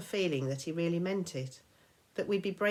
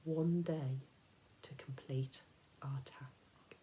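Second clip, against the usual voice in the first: much softer, slow whisper; grim whisper; 3 semitones lower, 6 dB quieter, slower than usual. A narrator's reading. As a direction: breathe out the deadline. Narrator grim about deadline.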